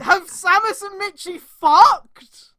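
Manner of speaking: high, loud, slow incredulous question; incredulous shout. Real, not acted. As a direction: demand it in disbelief. Stunned question about fanfic.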